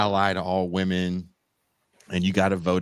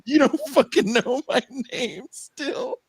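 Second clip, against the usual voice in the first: a touch of exasperated senator, pitched higher; hammed-up indignant complaint; mock outrage. Tim Kaine confronts the contestant who forgot his name, mock outraged. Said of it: "Tim Kaine runs up on him and goes".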